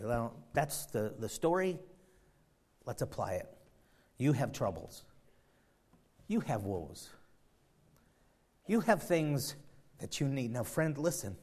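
usual speaking voice

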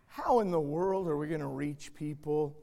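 usual speaking voice

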